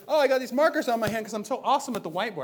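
fast, smug self-praise; mock humble brag. Pastor mocking his own whiteboard pride, fast smug brag. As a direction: brag lightly while pretending not to. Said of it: "I turn into a humble brag and say".

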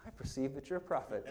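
deadpan understatement, near a laugh; understated. Retold or imitated. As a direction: say it coolly, barely bothered. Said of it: "so understated".

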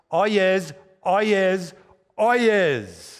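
town crier. Retold or imitated. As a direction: cry oyez like a court crier. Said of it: "bangs his gavel three times and says".

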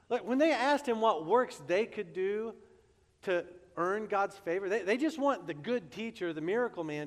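ordinary delivery

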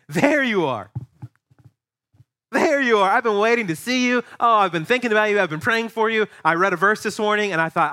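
light up at seeing a friend; eager welcome. Retold or imitated. Voicing loving welcomer.

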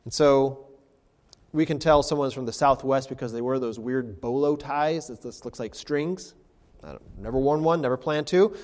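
normal talking voice

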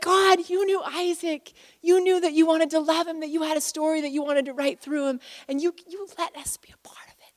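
high, hushed, tender wonder; moved prayer. Retold or imitated. Worshipper thanking God for the boy's story, high hushed prayer. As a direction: pray it softly, fading into a whisper.

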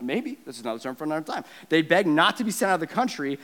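ordinary delivery

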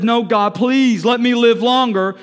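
loud pleading prayer; acted plea for life; Hezekiah's plea. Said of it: "He says".

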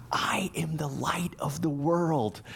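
slow whispered prompt, voice dropped away; whispered cue. Retold or imitated. Mother in the front row whispering the lines to her son. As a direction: whisper the line slowly to a stuck child. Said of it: "she kind of whispered in his direction".

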